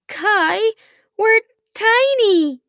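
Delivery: high, loud, drawn-out cry; alarmed yell. A narrator's reading. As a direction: yell the discovery to your brother. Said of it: "he heard June yell".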